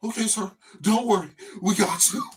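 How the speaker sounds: high, loud, hurried reassurance; mock a jumpy clerk soothing a customer; nervous cashier. Clerk reassuring the big scary customer, nervous loud voice.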